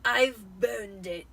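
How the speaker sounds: loud, slow, final uncle; blunt uncle. Acted. Uncle ending the talk with the boy, loud and slow. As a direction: drop it heavily and slowly.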